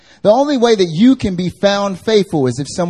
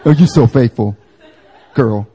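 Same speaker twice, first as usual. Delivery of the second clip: loud, slightly lowered gushing; mock a girl's praise in a deeper tone; 5 semitones lower, 7 dB louder than usual. Preacher redoes the girlfriend's praise, in a mildly deeper voice. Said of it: "took what the girl said and made a deeper voice".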